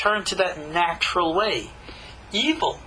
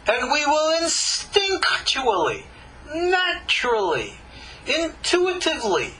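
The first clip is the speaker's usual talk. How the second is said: rising, fervent sermon voice, slowing on the big words; impassioned preaching; 5 semitones higher than usual. Acted. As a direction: preach it with full conviction. Preaching at full force.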